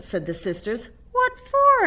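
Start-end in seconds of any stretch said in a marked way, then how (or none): 1.1-1.9 much higher, louder shocked question; surprised. Acted. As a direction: gasp it out in disbelief. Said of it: "asked Mama".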